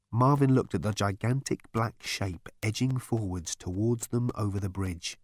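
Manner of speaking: ordinary delivery, nothing marked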